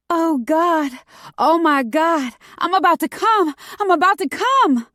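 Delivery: high, loud cries of climax; over-the-top ecstatic announcement to the world; overly dramatic cries. A narrator's reading. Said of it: "way louder than necessary".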